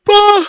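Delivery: loud, high yawn burst; real yawn. Real, not acted. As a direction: let a yawn burst out loudly. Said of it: "Sorry, yawning".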